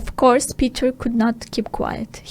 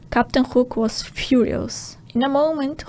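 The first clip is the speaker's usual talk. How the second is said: plain reading voice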